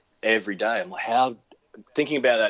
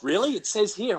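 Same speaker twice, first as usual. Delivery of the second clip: higher-pitched questioning surprise; mock puzzlement; 12 semitones higher than usual. Casting people checking his listed Russian, mock puzzlement. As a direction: query it, lightly imitating a puzzled caster.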